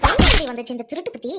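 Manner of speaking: loud burst at the start; angry, exasperated telling-off; scolding. Acted. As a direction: scold her for her thieving. Acted scolding of a thieving girl.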